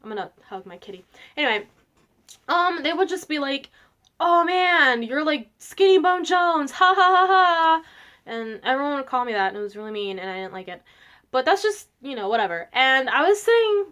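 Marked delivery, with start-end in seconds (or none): 4.2-7.8 higher, louder jeer with drawn-out fake laugh; mocking teasing. Kids teasing a skinny girl, mocking. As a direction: poke fun with a slow, loud "ha ha". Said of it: "people would make fun of me".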